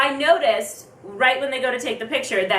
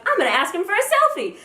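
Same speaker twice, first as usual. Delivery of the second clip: higher-pitched, gutsy announcement; ballsy; 8 semitones higher than usual. Retold or imitated. Fan decides to ask for a selfie, ballsy. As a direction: declare it with sudden nerve. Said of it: "ballsy attitude again".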